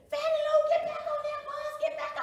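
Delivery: high, very loud frantic scream; screamed warning. Acted. Friends screaming at her to get back on the bus, frantic warning. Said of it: "And they just screaming".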